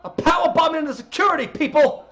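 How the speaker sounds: high, loud wrestling commentator impression; Taz, excited. Calling the balcony climb to Cole, excited Taz. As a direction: shout the play-by-play to your broadcast partner. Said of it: "as an excited Taz".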